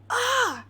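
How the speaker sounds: short loud frustrated "ah"; a sharp, exasperated cry of annoyance; frustrated yell. Retold or imitated. Frustrated at being spoiled.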